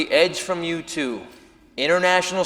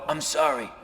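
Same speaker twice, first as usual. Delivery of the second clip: much softer timid apology; meek apology; 5 semitones lower, 8 dB quieter than usual. Retold or imitated. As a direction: mumble it like a dazed fan who just got punched. Imagined meek victim.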